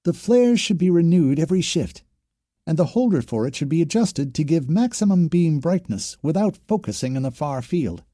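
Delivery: high-pitched drill recitation with a twang; parroting training officer. A narrator's reading. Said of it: "Yellowcrest went on".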